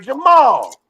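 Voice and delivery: mother yelling to her son about the agent, indignant. Retold or imitated. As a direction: demand her son check the plug. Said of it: "She's like".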